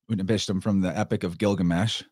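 ordinary talk, nothing marked

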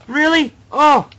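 high, loud cry of disbelief; exasperated yell. Real, not acted. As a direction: yelp in real frustration. Failing at the limbo game.

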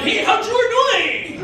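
high, drawn-out hammed-up greeting; chef's greeting. Chef greets his table, high mock cheery call.

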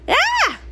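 sudden high scream of fright; sharp high-pitched shriek. Acted. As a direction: cry out as the magic grabs you. Pony in danger cries out.

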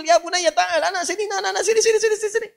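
high calling voice of the teacher. Acted. Teacher calls the young man. (guru memanggil murid dengan suara tinggi)

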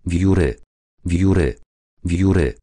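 ordinary delivery, nothing marked

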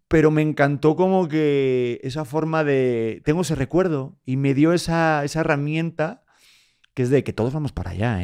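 ordinary talk, nothing marked

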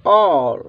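"ऑल" with a long rounded aw; model pronunciation. Shown as a demonstration. Teacher answers own reading question, "ऑल" with long aw. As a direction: sound out "ऑल" as a model word. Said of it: "इसको हम क्या पढ़ेंगे".

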